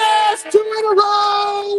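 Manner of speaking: high, slow, shouted cheer; excited cheer. Real, not acted. Cheering a correct answer.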